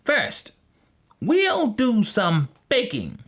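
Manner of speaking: high, slow witch voice; old witch. A narrator's reading. Old witch to Gretel before baking, high slow voice. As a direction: announce it slowly as a scheming witch. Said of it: "said the old witch".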